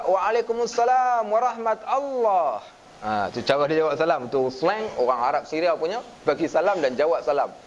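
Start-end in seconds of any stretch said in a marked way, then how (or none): 0.0-2.7 slow, high Syrian lilt on the reply. Shown as a demonstration. Lecturer demonstrating the Syrian way to answer salam, drawn out. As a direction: draw the reply out like a Syrian sheikh. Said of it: "Jawab pula".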